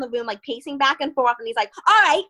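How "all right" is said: higher and louder, a sore loser demanding a rematch; mock angry yell. Beaten opponent demanding another round, mock angry yell. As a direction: bark it out like a pissed-off big guy. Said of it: "he starts yelling at me".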